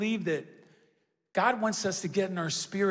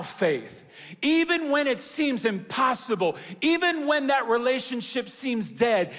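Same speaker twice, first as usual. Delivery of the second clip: rousing, emphatic church preacher; full-force preaching; 3 semitones higher, 3 dB louder than usual. Real, not acted. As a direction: drive each phrase home to the congregation.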